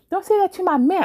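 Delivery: put-on high mimicry; mock confrontational voice. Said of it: "Why are you speaking like this".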